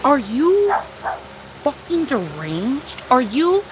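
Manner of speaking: slow, stretched incredulous question; drawn-out, aghast challenge; appalled disbelief. Real, not acted. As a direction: ask it as if stunned by their cruelty. Appalled at shelter abuse.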